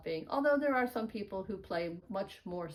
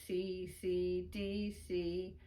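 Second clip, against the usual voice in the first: evenly spaced letters on a level pitch; soft, steady play-along recital; reciting note letters; 4 dB quieter, much slower than usual. Shown as a demonstration. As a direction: chant the letter names in time. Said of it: "say the, the letter names".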